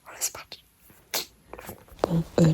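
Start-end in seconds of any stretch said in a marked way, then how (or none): 0.0-0.6 whispering. Real, not acted. Whispered intro.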